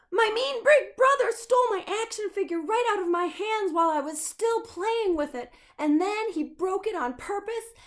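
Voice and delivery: put-on high, loud wailing kid rant; high loud whiny child list of woes; whiny crying child. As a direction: wail it all out like a sobbing kid. Crying child Sam's complaint.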